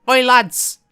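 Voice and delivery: loud, high Irish call-out; hammed-up Irish shout; bad Irish accent. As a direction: hail the group in a rough Irish accent. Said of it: "not gonna do a very good Irish accent".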